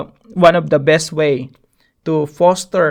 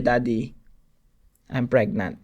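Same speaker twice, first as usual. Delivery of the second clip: daughter's confession; 5 semitones lower, 6 dB quieter than usual. Retold or imitated. Father voices daughter's confession.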